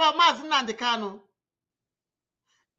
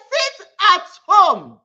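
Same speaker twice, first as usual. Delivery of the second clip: loud slow command; 7 dB louder, slower than usual. Real, not acted. Commanding followers to stay home.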